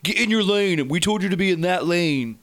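put-on high, loud bratty kid yelling; angry kid gamer. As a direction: yell like a bossy fourteen-year-old. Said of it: "to be yelled at by a fourteen-year-old".

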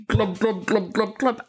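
short, even underwater bubble noises; mermaid glubbing. Acted. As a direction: imitate bubbles with a run of glubs.

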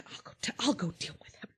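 quick whispered threat. Acted. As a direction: hiss it fast under your breath. Goneril's line voiced in a story.

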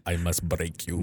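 mock Russian boxer, hushed and low; Ivan Drago. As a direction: intone it softly in a Russian accent. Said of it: "Ivan Drago".

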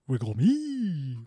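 slow, drawn-out small wail; a smaller, stretched-out echo of the comic cry; long-held last word, trailing down; silly character voice. Shown as a demonstration. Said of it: "The two voices of Will".

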